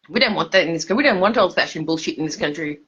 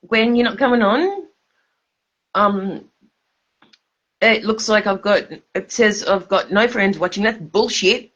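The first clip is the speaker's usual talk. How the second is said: ordinary talk, nothing marked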